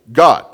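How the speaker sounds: God hit hard and loud; emphatic God. Shown as a demonstration. Preacher declaring God to the congregation, loud and absolute. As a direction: land God like an absolute fact. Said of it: "in an emphatic, absolute way".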